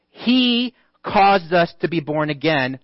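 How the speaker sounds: "he" held long and set apart by a pause; stress on HE. Real, not acted. Preacher reading scripture to the congregation, stress on "he". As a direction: lean hard on "he", then carry on evenly.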